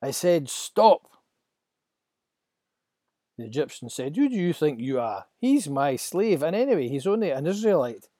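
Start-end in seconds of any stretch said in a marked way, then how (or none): 0.0-1.1 loud, slow, commanding stop; slow firm command. Acted. Moses commanding the Egyptian. 4.2-8.1 loud sneering overseer; a slave driver scoffing at Moses; arrogant slave driver. Acted. Egyptian slave driver voiced.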